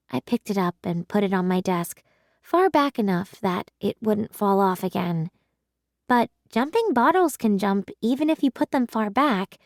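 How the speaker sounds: ordinary delivery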